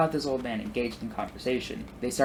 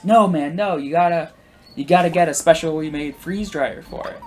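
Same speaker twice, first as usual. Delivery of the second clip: loud, high, emphatic imitation of an enthusiast; mock-insistent, pitched up and punchy; eager correcting; 6 semitones higher, 9 dB louder than usual. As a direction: set him straight with salesman zeal. Retold husband eagerly correcting the man.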